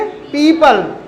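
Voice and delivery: पीपल with the first vowel drawn out; long stressed ee. Shown as a demonstration. Teacher answering how to read it, पीपल with long ee. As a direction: stretch the start of पीपल, "peee-pul". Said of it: "stress दे के बोलना है".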